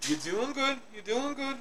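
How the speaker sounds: soft, high and gentle encouragement; reassuring. Real, not acted. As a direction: comfort a friend who is close to tears. Reassuring cohost who is holding back tears.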